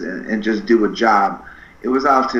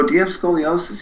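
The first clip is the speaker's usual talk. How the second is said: pitched-up probing question to a stranger; curious question; 7 semitones higher than usual. Retold or imitated. Asking a kid at the mall, a raised eager question. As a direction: lean in and ask it brightly.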